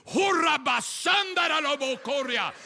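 high, forceful burst of tongues; speaking in tongues. Acted. Preacher voicing the woman facing her attacker, forceful tongues. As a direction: let the syllables ring out in fervent prayer. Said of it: "I began to speak in other tongues".

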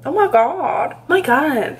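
ordinary talk

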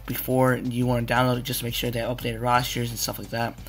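ordinary delivery

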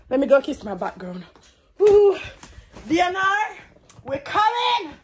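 real thrilled hype; excited showing off. Real, not acted. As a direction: gush over the new setup. Showing off her setup.

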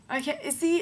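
usual speaking voice

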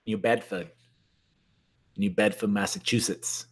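put-on low, soft try at a Massachusetts accent; attempted Massachusetts accent. Host trying a viewer's Massachusetts accent, low and soft. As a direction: drop the R in Bedford, low and soft, Massachusetts style. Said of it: "That's about as good as I, I get".

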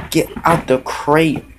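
ordinary talk

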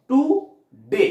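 টু, pause, ডে; টু ডে cut apart with a clear gap; split into two syllables. Shown as a demonstration. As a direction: say টু and ডে as two separate beats. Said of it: "কাটা হলো, দুটো হলো".

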